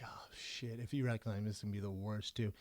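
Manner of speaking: low, hushed inner groan; muttered dread. Retold or imitated. Comic dreading recognition by his seatmate, muttered low and quiet. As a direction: think it aloud under your breath.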